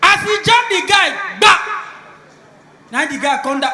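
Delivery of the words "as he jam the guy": normal talking voice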